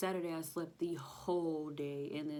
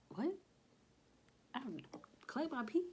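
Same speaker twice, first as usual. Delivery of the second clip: high, incredulous squeak of surprise; shocked disbelief; 9 semitones higher, faster than usual. Retold or imitated. Retelling the nurse's instructions, a high startled disbelief. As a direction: act out her stunned reaction. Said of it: "I was like".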